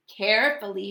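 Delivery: plain reading voice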